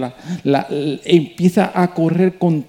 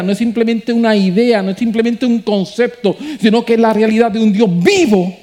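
preaching at full force; 5 semitones higher than usual. Acted. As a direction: preach it to the rafters. Preaching climax.

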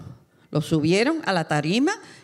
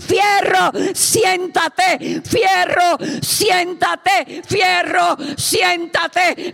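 high, loud repeated calls; urgent shouting; 9 semitones higher, 6 dB louder, much slower than usual. Retold or imitated. Wife shouts at Fierro to sit down, urgent shouting. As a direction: yell it like an urgent order, word by word. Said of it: "que le gritaba".

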